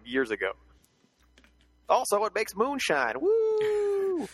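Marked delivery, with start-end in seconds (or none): 1.9-4.3 high, whooping hillbilly cheer. Pretend.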